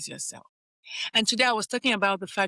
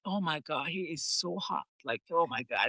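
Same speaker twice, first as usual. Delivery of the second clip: low, soft swoon; dreamy crush swoon, softer and lower; 6 semitones lower, 6 dB quieter than usual. Retold or imitated. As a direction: melt over how hot he is. Swooning over a hot guy.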